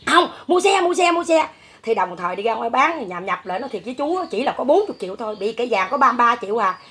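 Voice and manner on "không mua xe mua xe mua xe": insistent son. Retold or imitated. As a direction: hammer the demand like a spoiled son. Son insisting on buying the bike.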